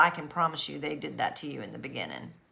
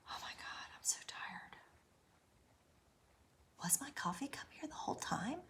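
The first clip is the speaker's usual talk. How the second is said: breathy whisper, worn out; tired whisper; 5 semitones higher, 14 dB quieter than usual. Retold or imitated. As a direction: whisper it, drained and doubting. Exhausted whispering to herself.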